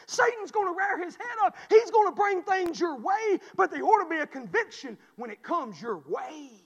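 full-force revival preaching. Acted. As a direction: preach it out to the whole church.

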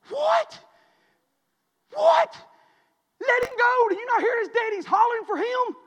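very high, loud hollering; shrieked disbelief, then a hollered order; neighbor's yell. Retold or imitated. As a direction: yell it down the hill in imitation. Replays neighbor's yell to boy.

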